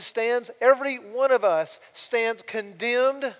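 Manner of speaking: loud, rising sermon on sin and judgment; fiery preaching. Acted. Preacher warning the congregation of God's wrath, loud and driving. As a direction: thunder it from the pulpit.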